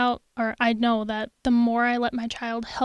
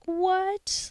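put-on higher, curious kid voice; put-on wide-eyed curiosity; intrigued child; 8 semitones higher than usual. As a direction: imitate a child's amazed question. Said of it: "your child's like".

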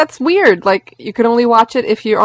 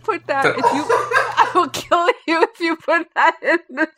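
laughing; 7 semitones higher, slower than usual. Real, not acted. Laughing mock threat.

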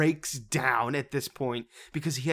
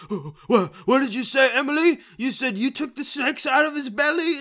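much higher, louder disbelieving voice; mocking Jake; 12 semitones higher, 6 dB louder than usual. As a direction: squeak it out in shocked disbelief. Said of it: "and he's like".

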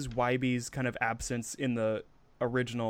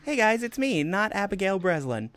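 put-on much higher, sweet child-star hello; mock Dakota Fanning greeting; 8 semitones higher than usual. Jokingly greeting the podcast hosts as a child star. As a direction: chirp it like a guest star arriving. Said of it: "I'm so overwhelmed".

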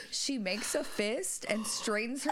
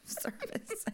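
usual speaking voice

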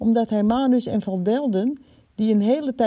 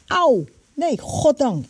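high, sudden ow, then relief; acted twinge of pain; pained exclamation; 5 semitones higher, 4 dB louder, slower than usual. A narrator's reading. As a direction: yelp lightly, then thank heaven. Sudden pain ends the dream.

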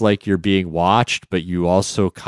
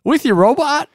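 surprised; 4 dB louder than usual. Real, not acted. Laughing disbelief continues.

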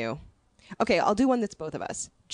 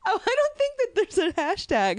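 high put-on voice; 14 semitones higher than usual. Real, not acted. Giddy reply in high voice.

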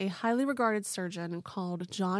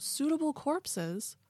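sarcastic quote marks; 7 semitones higher, slower than usual. Retold or imitated. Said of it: "quote unquote".

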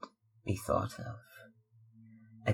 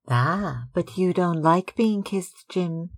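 higher, louder playful exclamation; teasing cry; 7 semitones higher, 5 dB louder than usual. Acted. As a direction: tease her brother, bright and loud. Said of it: "she cried".

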